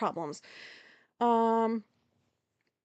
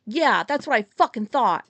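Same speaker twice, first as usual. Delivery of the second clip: much louder mock taunting yell; 8 dB louder than usual. Grocery worker yelling at the locked car, a taunting mock yell. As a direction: throw it after her as a taunt. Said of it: "heard her yell out".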